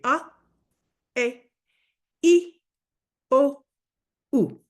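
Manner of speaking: "A, E, I, O, U" each pure and clipped apart; teacher modelling vowels for repetition; vowel demo. Shown as a demonstration. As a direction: model each Spanish vowel "A", "E", "I", "O", "U" cleanly for students to echo. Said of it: "Repeat after me".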